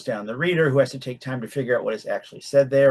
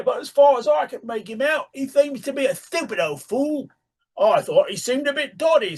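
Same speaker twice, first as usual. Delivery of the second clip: high put-on rustic British voice; old farmer voice, pitched up; country man; 14 semitones higher than usual. A narrator's reading. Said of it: "said the carpenter".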